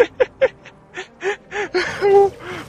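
loud, high cackling; shrieking high laughter; panicked laugh. Real, not acted. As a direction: burst out laughing mid-fight.

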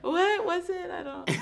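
high flustered confusion. Retold or imitated. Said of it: "I was like".

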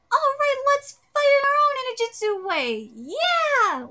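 high, loud battle cry; loud, high team rally; rallying shout. Acted. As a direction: rouse the team to fight. Character rallies the team.

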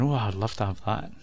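usual speaking voice

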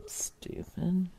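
breathy whispered stupid; muttered whisper at the phone; whispered insult. Real, not acted. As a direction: hiss it under your breath.

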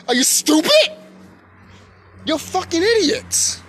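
high, loud, incredulous scolding; exasperated outburst. Real, not acted. Ranting at people who won't think, exasperated.

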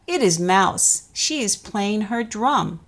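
plain reading voice

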